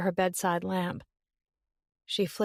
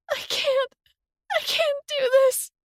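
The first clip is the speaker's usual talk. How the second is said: far higher, wailing; breathless wail; 5 dB louder than usual. Acted. Summer wailing on the phone, out of breath. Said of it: "Summer wailed, out of breath".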